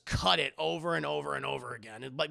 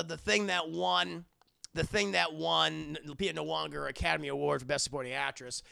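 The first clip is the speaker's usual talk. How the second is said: normal talking voice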